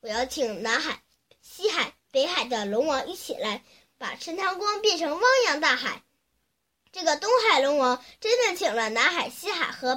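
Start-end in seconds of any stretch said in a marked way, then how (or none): none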